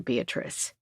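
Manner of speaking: soft worried whisper; whispered name. Acted. Worried voicemail, name breathed out.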